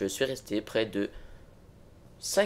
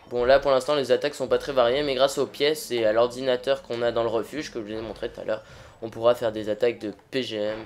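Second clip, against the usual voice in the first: usual speaking voice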